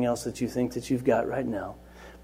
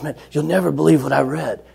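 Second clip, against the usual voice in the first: louder, keen disbelief; excited boy; 3 semitones higher, 7 dB louder than usual. Retold or imitated. Boy tells the teacher about his Bible reading, excitedly. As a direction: imitate a kid bursting to share. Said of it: "the boy's getting really excited".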